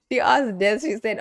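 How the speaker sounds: usual speaking voice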